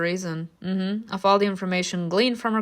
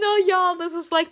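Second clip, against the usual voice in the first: outraged; 9 semitones higher than usual. Real, not acted. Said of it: "the injustice that I can't stand".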